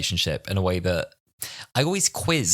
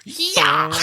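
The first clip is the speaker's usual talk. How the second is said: high, loud comic shout; hammed-up cry of effort; mock battle yell; 6 dB louder than usual. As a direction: yell it as if hurling something. Acting out the throw in the gag.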